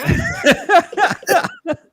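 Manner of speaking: high, loud laughing "I ain't"; laughing refusal. Retold or imitated. Refusing his teammate's ticket bill, high and laughing.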